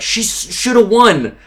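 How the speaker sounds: high, loud outraged cry; outraged fan. Retold or imitated. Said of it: "they were like".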